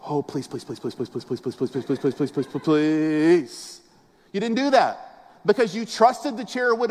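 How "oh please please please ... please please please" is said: hushed, low, frantic begging; mock pleading. Pastor mocks a nervous churchgoer begging the chair, hushed pleading. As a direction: beg the chair to hold you, in a hushed voice.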